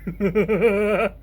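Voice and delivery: breathy sinister cackle; evil laugh. Shown as a demonstration. Showing off his voice with a villain's cackle. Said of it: "the fucking evil laugh".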